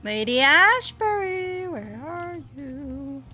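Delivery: drawn-out high call, searching; sing-song calling. Pretend. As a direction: call out her name slowly, lilting. Calling out for lost character.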